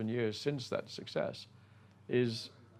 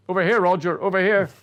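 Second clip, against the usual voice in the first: loud call across the room; 8 semitones higher, 11 dB louder than usual. Retold or imitated. Calling to Roger across the coffee shop, loud call. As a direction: call out to be found.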